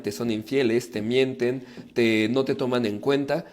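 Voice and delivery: ordinary delivery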